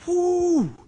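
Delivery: loud high whoop of joy; high, loud celebratory whoop; excited whoop. Retold or imitated. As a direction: let out a kid's cheering whoo. Kids cheering at phone call.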